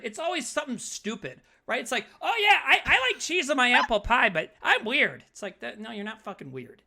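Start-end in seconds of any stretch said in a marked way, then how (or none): 2.2-5.2 mock Midwest mom. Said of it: "some mom or aunt out here in the Midwest go like".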